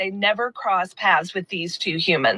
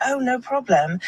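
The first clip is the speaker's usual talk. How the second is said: put-on British accent, pitched higher; 4 semitones higher than usual. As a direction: play a polite Brit with an imitated British accent. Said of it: "that was my British accent".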